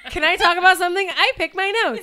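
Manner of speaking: high, giggling confession; giggly confession. Real, not acted.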